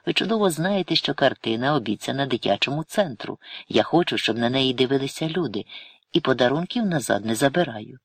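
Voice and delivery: usual speaking voice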